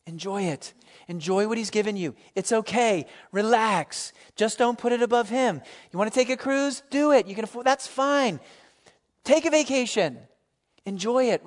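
high, exuberant permission-giving; emphatic encouragement. Real, not acted. Preacher freeing congregation to enjoy God's gifts, emphatically encouraging.

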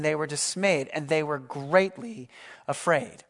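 usual speaking voice

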